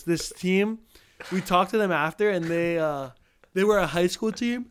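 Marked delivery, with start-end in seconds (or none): 3.5-4.7 talking through a yawn. Real, not acted. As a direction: yawn stretches the words. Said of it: "Oh, sorry".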